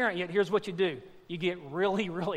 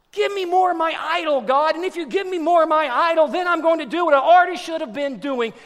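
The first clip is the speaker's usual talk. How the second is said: high, loud, rushed pleading; demanding mock prayer; 7 semitones higher, 8 dB louder, faster than usual. Preacher mimicking people's lame prayers, demanding. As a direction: rattle off a pushy prayer.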